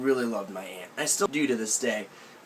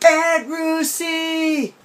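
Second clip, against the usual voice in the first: put-on long drawn-out high child's call, much louder; a six-year-old stuck in a tree yelling for his aunt; childish yell; 14 semitones higher, 6 dB louder, much slower than usual. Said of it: "bear with me".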